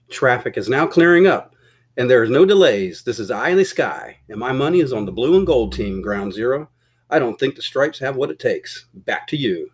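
punchy broadcast sing-song with big pitch swings; radio traffic reporter. Acted. Helicopter reporter updating the studio on traffic, broadcast patter. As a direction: report it like an eye in the sky.

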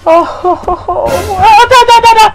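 high startled cries breaking into stammer; loud, frightened babbled yelps; jump-scare yelping; panicked yelp. Real, not acted. Startled by horror game.

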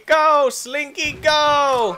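high cheer urging the slinky along; high slow cheer. Pretend.

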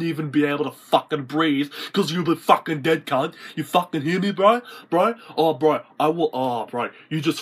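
worked-up bogan tough-guy threats; acted threatening rant. Threatening someone with cousins and bikie gangs, mock-tough rant. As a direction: threaten him with hammed-up aggression.

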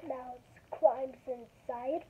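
slow, careful picture-book reading; reading to children. Acted. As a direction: read each page slowly to kids. Reads picture book aloud.

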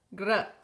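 gr said as one blend, the G running into a tapped R; gr sound. Shown as a demonstration. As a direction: join G and R in gr. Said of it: "Aquí tenemos G, R". (pronuncia gr uniendo la ge y la erre)

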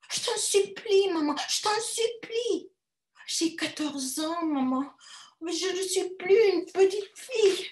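high pleading voice; begging girl. A narrator's reading. Girl begs her mother to let her stay, pleading.

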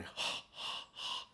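soft open exhale, like fogging a mirror; a relaxed open exhale; foggy breath out. Shown as a demonstration. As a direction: breathe out wide and easy, unvoiced. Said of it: "trying to fog up a mirror".

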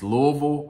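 Louisville run together as LOO-uh-vul; accepted local pronunciation. Shown as a demonstration. Correcting outsiders on his hometown, local pronunciation. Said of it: "that's what we will accept".

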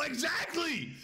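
high shout of agreement; excited shout. Real, not acted. Streamer yelling agreement with chat. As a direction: yell it in triumph.

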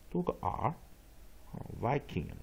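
normal talking voice

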